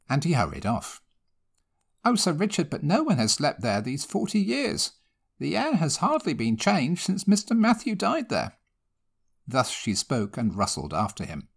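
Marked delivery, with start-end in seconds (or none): 2.0-8.6 put-on high, worried old servant pleading; fretful housekeeper. A narrator's reading. Housekeeper begging her master not to sleep there, high worried voice. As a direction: protest high and anxious, like a frightened maid. Housekeeper protesting the haunted room.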